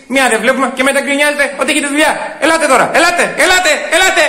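high, loud, exasperated rant; shouting complaint. Acted. Customer berating understaffed counter every Saturday, shouting. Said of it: "Δεν φωνάζω".

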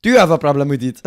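put-on loud, fast challenge in French accent. French envoy challenging the host over terms, French accent. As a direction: confront them as a hammy French negotiator. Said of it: "Fuck this French accent".

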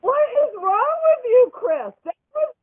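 incredulous outburst. Real, not acted. Defending the recording to Chris, high and incredulous. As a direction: squeal at her for not getting it.

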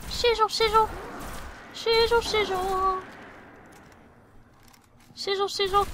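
high, playful sizzle chant; mock sizzling. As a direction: squeak it like crackling electricity. Mimics electricity sound in high voice.

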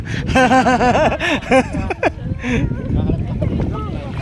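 long real burst of laughter; hearty laugh. Real, not acted. Man joking about peeing in sea, hearty laugh. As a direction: laugh it out in pulses. Laughing after admitting urge to pee.

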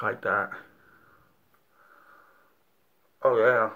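pulling at denture while talking; fingers in mouth. Real, not acted. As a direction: hand tugging at teeth. Seen 1: Fingers inside mouth tugging at teeth during early words, then removed.

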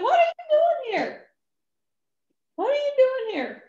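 high, loud challenge; sharp demand. Retold or imitated. Mechanic challenging the little man, loud and high. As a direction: snap the question at him.

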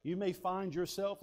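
usual speaking voice

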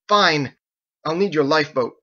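loud clipped surrender; frustrated man conceding; angry concession. Acted. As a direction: give in through anger. Ezekiel gives in angrily.

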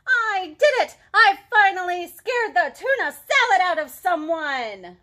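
loud, high gloating celebration; triumphant cheer. A narrator's reading. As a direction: crow over a long-awaited win. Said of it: "cheered Leonardo".

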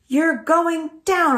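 high, loud, slow challenge yell; screaming. Acted. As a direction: scream it at a racing rival. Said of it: "I scream".

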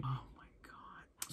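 slow unvoiced whisper of dread. Real, not acted. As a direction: breathe it out in horror.

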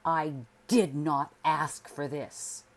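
loud and slow, every word hammered; husband pounding the table, shouting at his wife; furious outburst. A narrator's reading. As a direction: slam it out like a fist on the table. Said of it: "pounded the table with his fist".

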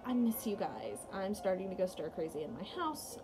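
ordinary talk

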